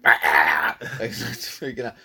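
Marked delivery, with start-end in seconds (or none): 0.0-0.8 chihuahua yelp. Retold or imitated. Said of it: "this little chihuahua's like".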